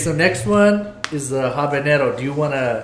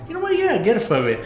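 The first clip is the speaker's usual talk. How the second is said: much higher, smug put-on reply; mock lordly acceptance; 9 semitones higher than usual. Passenger retells accepting the blanket, smug mock voice. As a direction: act grandly pampered. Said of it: "I'm all".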